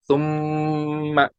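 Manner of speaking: सुम्मा very slow, the mm hummed long; held ghunna. Shown as a demonstration. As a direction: stretch the mm of सुम्मा through the nose. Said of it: "उनमें गुन्ना होगा". (सुम्मा में लंबा गुन्ना)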